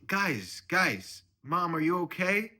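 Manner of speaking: high, loud intervention; worried daughter. Acted. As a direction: call out over the quarrel, pitched high. Daughter breaks up acted quarrel.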